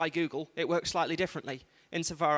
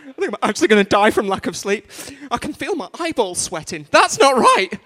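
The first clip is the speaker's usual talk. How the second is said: building to a high, loud mock freak-out; mock panic; 4 semitones higher, 10 dB louder than usual. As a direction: act increasingly alarmed, ending in a shout. Acting out sleep-deprived panic.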